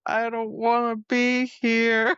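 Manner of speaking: slow, dragged-out dread; hammed-up awkward misery; mock reluctance. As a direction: draw every word out, imitating sheer discomfort. Voices Azriel dreading the breakfast table.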